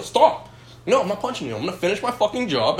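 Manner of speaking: high-pitched, exasperated retold refusal; worked-up mock pleading refusal; acted protest. Retelling his refusal to punch her.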